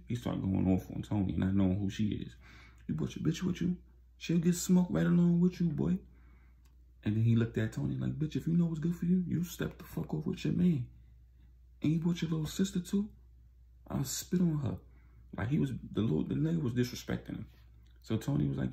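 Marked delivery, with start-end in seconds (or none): 2.9-14.8 high, mocking, aggressive taunts; high-pitched retold taunting; hostile street thug. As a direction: sneer at them like you run the block. Dealer threatening Tony.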